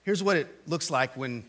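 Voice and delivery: ordinary delivery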